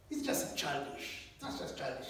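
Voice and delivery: unvoiced, contemptuous brush-off; dismissive scoff. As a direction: wave it away under your breath. Mock dismissive believer voiced.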